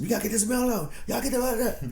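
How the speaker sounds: high, hammed-up bossy urgency; mock uptight boss. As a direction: parody of an overserious supervisor rushing the mail. Mocking uptight post office coworkers.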